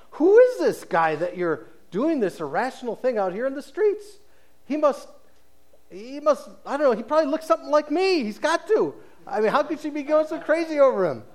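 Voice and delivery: high, quick joking impression of the doubting friends; mocking gossip friends. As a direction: sneer at her choice like a snide friend. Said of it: "Okay, that was a bad joke".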